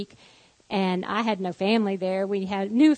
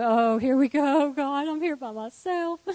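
mildly exasperated wail, rising higher; overwhelmed lament; 5 semitones higher than usual. Retold or imitated. Mother alone with sick children, overwhelmed lament. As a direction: act out being left to cope alone.

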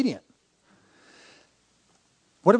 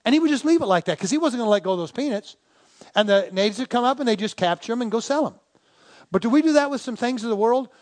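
ordinary talk, nothing marked

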